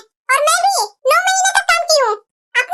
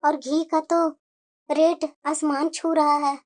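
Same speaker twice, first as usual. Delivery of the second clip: much lower, softer hesitant mother; worried mother voice; 12 semitones lower, 6 dB quieter than usual. Acted. Mother worried about costly laddoos, low hesitant voice. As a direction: fret about the expense.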